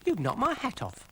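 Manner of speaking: high snail complaint; a squeaky snail put out; Brian the snail. Acted. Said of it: "said Brian".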